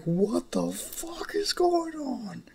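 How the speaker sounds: high, slow, drawn-out disbelief; stunned yell. Acted. Young Izuku seeing his future family, slow, high-pitched disbelief. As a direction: stretch it out in total shock. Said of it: "And it's like".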